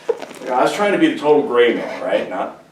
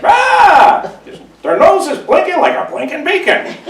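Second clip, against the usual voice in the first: much higher, louder schoolyard taunt; mocking reindeer jeer; 8 dB louder than usual. Reindeer taunt Rudolph, high loud jeering. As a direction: shriek the tease like a bully kid.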